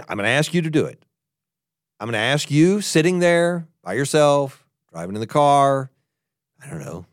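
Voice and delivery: usual speaking voice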